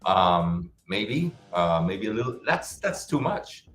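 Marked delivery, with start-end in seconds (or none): 2.4-3.7 high, slow disbelief; surprised. Real, not acted. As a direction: stretch it out in disbelief. Reacts to high installment.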